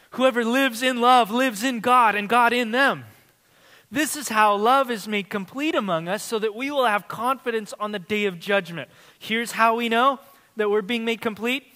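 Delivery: loud, lifted preacher's voice with long pauses; full-force preaching. Acted. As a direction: preach it out over the congregation.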